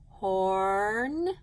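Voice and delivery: "Horn." held long, rising slowly at the end; stretched chunk. Shown as a demonstration. Teacher dictating the first syllable to pupils, drawn out. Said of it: "Could I write that whole chunk?".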